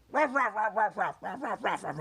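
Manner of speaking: high, loud yappy "ruff" barks; imitating dog barks. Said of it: "he'll just be like".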